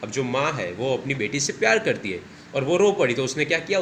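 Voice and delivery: usual speaking voice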